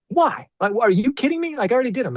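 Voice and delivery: high, exasperated "why?"; indignant protest. Retold or imitated. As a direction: push back like it's absurd. Client protesting redoing videos.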